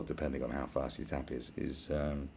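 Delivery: normal talking voice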